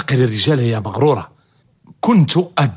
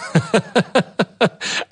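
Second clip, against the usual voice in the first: sly chuckle; 5 semitones higher than usual. Acted. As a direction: chuckle before the reveal. Laughs at his own news.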